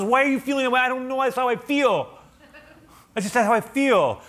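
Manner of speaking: high, loud frustrated back-and-forth; exasperated argument. Acted. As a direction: act out a heated protest about feelings. Acted argument about one's feelings.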